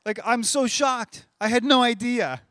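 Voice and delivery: high, loud hammed-up astonishment; mock shocked God. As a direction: act stunned by news you already knew. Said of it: "But he's like".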